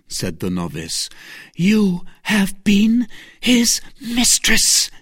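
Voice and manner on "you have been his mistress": slow, high, weighted accusation; pointed, high-pitched charge, word by word; accusing. A narrator's reading. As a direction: hammer out each word accusingly. Novice accuses Milady of being mistress.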